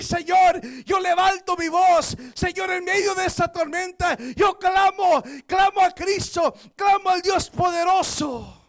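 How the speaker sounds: shouted fervent prayer; loud cry to God. Acted. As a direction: cry out to the Lord at full voice. (clamor a Dios a gritos)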